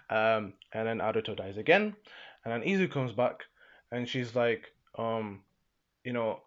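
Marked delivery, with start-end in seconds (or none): none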